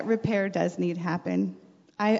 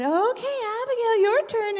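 much higher, bright instructor call; imitating instructor; 13 semitones higher than usual. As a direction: play the cheery swim teacher calling the child. Mother voices swim instructor.

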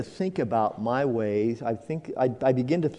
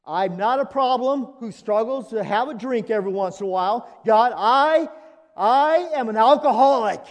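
higher and louder, crying out the admission; anguished confession; 10 semitones higher, 4 dB louder than usual. Retold or imitated. Sinner confessing to God, raised and pained. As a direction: confess it like it's torn out of you. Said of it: "I'm an alcoholic".

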